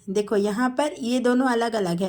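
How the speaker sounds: ordinary talk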